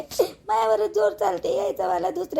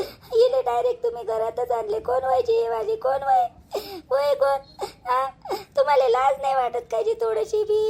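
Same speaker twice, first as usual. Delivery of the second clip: tearful accusation. Acted. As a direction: voice breaking with sobs between reproaches. Wife confronts husband, weeping.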